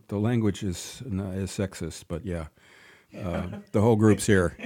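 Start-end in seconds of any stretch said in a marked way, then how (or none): none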